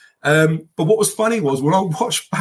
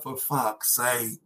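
muttered dread; 3 semitones lower, 8 dB quieter, much slower than usual. Retold or imitated. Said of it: "I was like".

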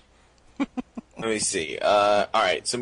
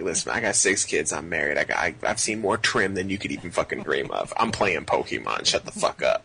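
low, indignant self-defense; mock tough-guy rant pitched down; defensive; 6 semitones lower than usual. Giving reasons why he's not a loser, defensive. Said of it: "almost defending yourself".

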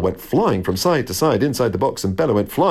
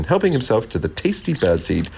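usual speaking voice

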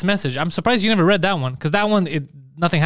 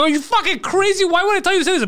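very high, incredulous mock scolding; retold exasperation; 13 semitones higher than usual. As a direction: replay telling off a clueless friend, pitch way up. Acts out scolding his friend.

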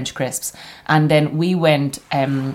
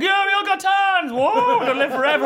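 put-on gleeful kids. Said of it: "we were like".

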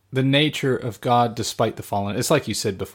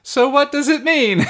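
laughing innocent question; 6 dB louder than usual. Retold or imitated. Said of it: "And then I said".

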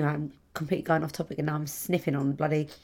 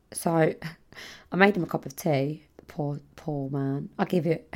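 normal talking voice

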